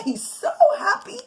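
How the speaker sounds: high, laughing delight; happy laughing narration. A narrator's reading. Mother to her children, laughing with delight. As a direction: let the joy spill into a laugh.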